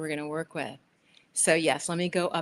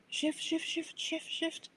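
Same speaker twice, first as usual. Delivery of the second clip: cooing at the dog; 11 semitones higher, 6 dB quieter than usual. Real, not acted. To her dog on the sofa, high cooing coax. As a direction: coo the dog over, sweet and high.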